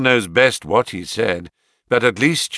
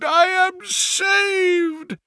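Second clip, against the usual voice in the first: even higher and slower, stretched cry; joyful repeat; much slower than usual. Acted. Banker repeating his rescue, higher and slower in ecstasy. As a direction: sing out the relief once more. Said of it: "With a shriek of joy".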